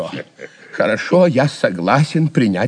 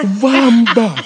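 joyful surprise; 11 semitones higher, 6 dB louder than usual. Acted. Recognises his jester in disguise.

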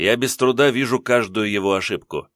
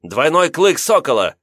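loud battle cry, higher; shouting; 7 semitones higher, 5 dB louder than usual. Acted. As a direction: yell the attack name. Youth shouts attack name again.

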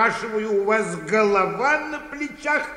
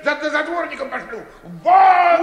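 fast, higher, furious threats; angry yelling clerk; 7 semitones higher, 4 dB louder, much faster than usual. Acted. Clerk yelling at the petitioner to get out. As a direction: shout her out of the office.

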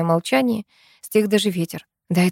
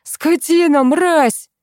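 high, loud string of insults; outraged, high-pitched holler; furious yell; 10 semitones higher, 6 dB louder, much slower than usual. A narrator's reading. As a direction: hurl the abuse at full voice. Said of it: "заорал Фёдор".

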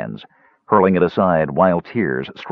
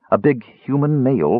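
plain reading voice

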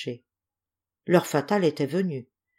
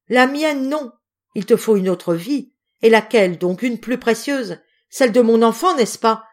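loud, high, horrified accusation; mounting maternal outrage; outraged cry; 5 semitones higher, 8 dB louder than usual. A narrator's reading. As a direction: cry out and drive the monster away. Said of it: "s'écria-t-elle en se relevant".

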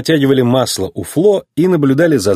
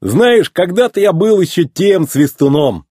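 slow, higher-pitched folksy bragging; boastful; 6 semitones higher than usual. A narrator's reading. Mater bragging to straining McQueen, boastful. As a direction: brag about the old days, taking your time. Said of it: "похвастался мэтр".

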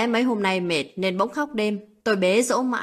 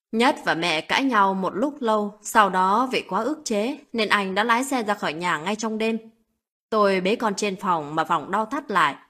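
ordinary delivery, nothing marked

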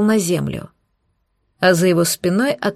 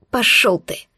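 spat out; slower than usual. A narrator's reading. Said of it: "Выплюнула я".

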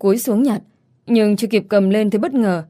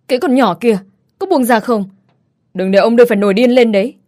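higher, louder, fast furious yell; shouting loudly; 3 semitones higher, 5 dB louder than usual. Acted. Pinned customer bellowing at the shop girl, loud and fast. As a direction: bellow at her to let go. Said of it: "la lớn lên".

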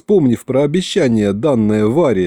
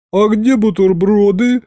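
high-pitched mock indignation; indignant demand; 9 semitones higher than usual. A narrator's reading. Narrator looks at the butler's tray, high indignation. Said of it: "возмутился я".

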